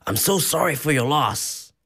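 put-on a light American accent on a sympathetic line; mild American accent, sincere sympathy; imitating an American stranger. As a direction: play the earnest American tourist offering condolences. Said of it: "this random American person just walks over".

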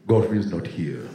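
low, regal Nigerian father; father's Nigerian accent. Retold or imitated. Father answering a call for Godfrey, low Nigerian accent. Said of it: "thought they were talking to the Lion King".

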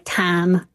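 TAM with the I flattened toward ah; Southern-style TAM, vowel like ah. Shown as a demonstration. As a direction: say "tam" for time, the I becoming ah. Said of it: "turning the I vowel into".

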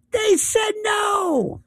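high-pitched, drawn-out cry of protest; impassioned outcry. Real, not acted. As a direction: cry it out as the climax. Emphatic climax after list of leaders.

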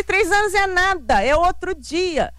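high-pitched retold indignation, slowing at the end; offended protest. Daughter rebuts her family's talk of three years, offended protest. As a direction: argue back, mock-scandalized and high. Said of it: "eu tava ofendidíssima".

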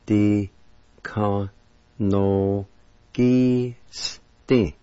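"di, ka, no, gi, s, ti" each held apart; teacher spacing out every syllable of "di, ka, no, gi, s, ti". Shown as a demonstration. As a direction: sound out "di... ka... no... gi... s... ti" slowly. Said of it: "Second part".

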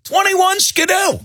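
high-pitched yell to scatter; mock-panicked lookout shout; urgent warning cry. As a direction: call out the alarm like a kid fleeing. Acting out kids fleeing from police.

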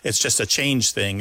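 ordinary talk

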